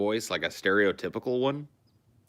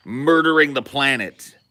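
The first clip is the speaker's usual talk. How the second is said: slow, loud, higher punch; emphatic outburst; 7 semitones higher, 10 dB louder, slower than usual. Real, not acted. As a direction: hammer the words home. Ranting against planet-killing culture.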